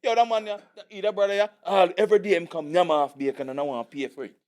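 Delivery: complaining in a put-on Jamaican accent; hammed-up Jamaican grievance; Jamaican accent impression. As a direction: rant about a thief in mock Jamaican patois. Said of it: "it's like Italian versus Jamaican".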